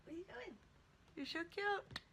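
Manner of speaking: high, soft cooing. Real, not acted. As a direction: coo to a kitten. Cooing at the cat.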